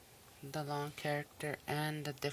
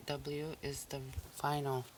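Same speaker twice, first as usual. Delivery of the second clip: usual speaking voice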